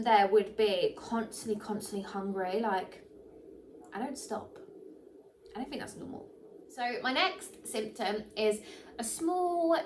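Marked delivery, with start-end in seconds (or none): 3.9-6.3 hushed confession. Real, not acted. Confessing constant hunger.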